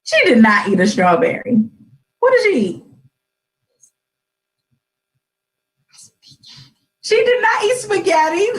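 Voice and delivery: playful high-pitched protest, rising in amazement; mock disbelief. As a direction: exclaim it in teasing astonishment to a small child. Mother jokingly corrects child's silly answers.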